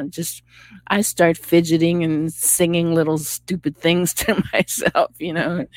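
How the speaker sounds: usual speaking voice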